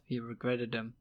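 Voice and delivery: ordinary delivery, nothing marked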